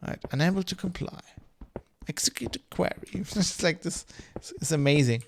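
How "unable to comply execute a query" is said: higher, softer, faintly annoyed ship computer; a hint of an irritated Starfleet computer; mildly pissed-off computer voice. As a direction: play a computer that's barely tolerating you. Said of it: "I mean, I can't even do it".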